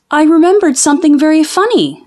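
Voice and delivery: put-on high, fast, gleeful boy voice; giddy boy. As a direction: rattle it off like an excited kid. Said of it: "said the boy".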